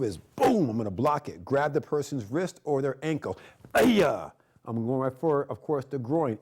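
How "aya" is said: sharp, loud karate shout; a martial arts yell on the strike; loud kiai. Shown as a demonstration.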